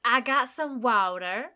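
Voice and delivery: I got some water with American wah-der; American accent. Shown as a demonstration. Co-host nails the American water. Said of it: "Oh, there we go. That's how you do it".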